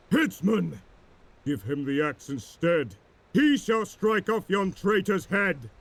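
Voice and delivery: high, commanding call; admiral's order. A narrator's reading. Drake ordering the headsman to behead Kane, high commanding call. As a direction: bark the order across the deck.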